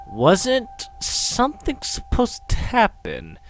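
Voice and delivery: high, slow questioning; drawn-out, high-voiced bafflement; puzzled frustration. A narrator's reading. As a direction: stretch the question out, pitched high. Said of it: "frowning more in frustration than in fear".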